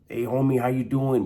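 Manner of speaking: imitating an Italian inmate's thick accent. Greeting the homies at canteen in a thick Italian accent. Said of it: "But with really thick accent".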